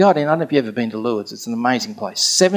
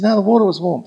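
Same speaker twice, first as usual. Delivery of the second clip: higher-pitched retold contradiction; firm insistence; 6 semitones higher, 3 dB louder, faster than usual. Friend contradicts the speaker about the water, insistent. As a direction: push back in a raised pitch.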